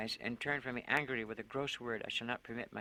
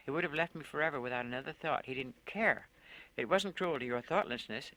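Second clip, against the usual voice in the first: ordinary delivery, nothing marked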